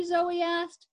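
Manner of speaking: plain reading voice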